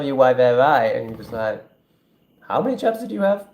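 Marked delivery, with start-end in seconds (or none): 2.4-3.5 high-pitched, mock-incredulous question; comically squeaky deadpan comeback. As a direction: pitch it way up, poking fun. Mocking a reply to plebs.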